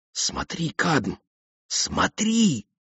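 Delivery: high, soft, urgent whisper; excited whisper. A narrator's reading. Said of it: "шепнула она". (взволнованный шёпот)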